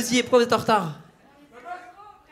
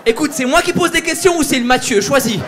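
higher and louder, fake outraged; mock scolding; 5 semitones higher, 7 dB louder than usual. Comedian scolds heckler, mock outraged, loud. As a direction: tell him off like a fed-up teacher.

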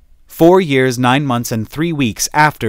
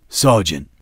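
captain; 5 semitones lower than usual. Acted. Captain calls him back.

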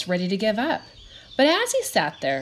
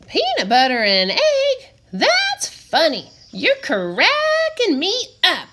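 amused; 9 semitones higher, 6 dB louder than usual. A narrator's reading. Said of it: "Egg laughed".

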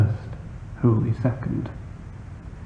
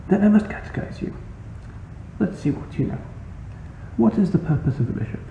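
high, lightly voiced old cleric, softer; quizzing old priest; 8 semitones higher, 4 dB quieter, faster than usual. Priest catechizes the narrator, high and lightly voiced. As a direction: play a small old priest testing a pupil.

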